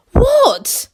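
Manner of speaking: high eager crowd cry; all cry out at once, high; eager chorus. Acted. Gnomes cry out together, high and eager. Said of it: "they chorused".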